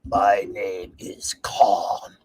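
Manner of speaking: low, slow breathy whisper of the villain line; mock menacing Khan whisper. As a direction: sneer it out slow and hushed like a cheesy villain. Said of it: "there are two times when he says".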